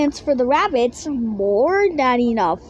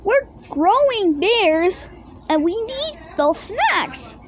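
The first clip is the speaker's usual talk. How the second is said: protesting cubs; 6 semitones higher than usual. A narrator's reading. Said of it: "they protested".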